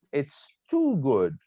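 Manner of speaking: too swung high, then falling through good, slowly; emphatic up-down tone. Shown as a demonstration. Teacher shows how to add emotion, up-down emphasis on too. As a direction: stretch It's too good, rising high on too and dropping. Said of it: "show emotions or aid emphasis".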